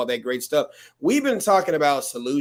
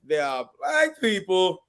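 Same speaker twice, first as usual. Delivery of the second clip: Chris Rock; 4 semitones higher, slower than usual. Retold or imitated. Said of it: "Chris Rock even said".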